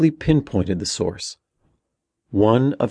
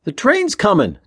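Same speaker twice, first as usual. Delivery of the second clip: high, loud warning yell; urgent shout; 5 dB louder than usual. A narrator's reading. Tommy to Ben about the coming train, shouting. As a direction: shout it to a brother in danger. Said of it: "Tommy shouted".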